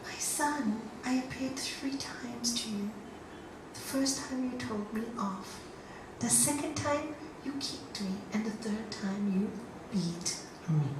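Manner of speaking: soft, hushed, measured voice; God's gentle reply. Acted. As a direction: answer very softly as God, letting pauses fall. God's voice gently answers the man.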